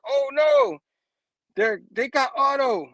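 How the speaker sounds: high, drawn-out dismay; alarmed cry. Acted. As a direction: cry out as a friend is taken. Spider-Man alarmed at abduction.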